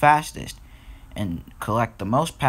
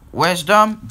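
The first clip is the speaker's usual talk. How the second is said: loud, raised stern call; booming; 7 semitones higher, 7 dB louder than usual. A narrator's reading. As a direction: boom the name out as an angry boss. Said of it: "boomed the Fat Controller".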